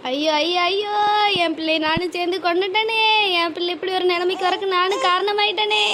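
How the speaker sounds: ordinary delivery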